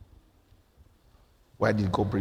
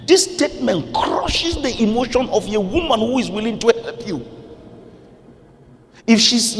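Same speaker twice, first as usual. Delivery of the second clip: high, loud, fiery sermon; full-force preaching; 12 semitones higher, 6 dB louder than usual. Real, not acted. Pastor scolding couples for wounding words, fiery and loud. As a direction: preach it hard at husbands and wives.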